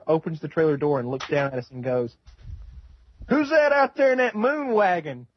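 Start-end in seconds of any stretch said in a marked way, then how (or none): 3.3-5.3 hillbilly uncle. Retold or imitated. Hillbilly uncle greeting them.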